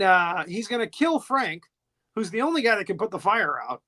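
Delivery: ordinary talk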